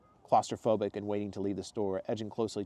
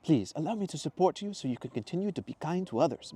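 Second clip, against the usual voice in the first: put-on higher, serious foreign-accented plea; earnest Mateo; 7 semitones higher than usual. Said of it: "Mateo said more seriously".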